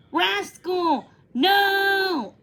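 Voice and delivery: high, loud desperate howl. A narrator's reading. Ellie howling at Rascal to stop. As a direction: yell after the runaway dog. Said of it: "Ellie howled".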